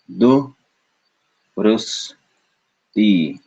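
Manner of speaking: "Du, rus, ti" as three separate beats; syllable by syllable. Shown as a demonstration. Teacher showing the chosen split, "Du, rus, ti" in beats. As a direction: pause after "Du" and "rus". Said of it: "we chose to say du".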